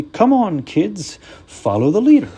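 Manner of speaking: high, loud cartoon snowman; rally the kids like a cheery snowman; jolly snowman voice. Frosty leads parade.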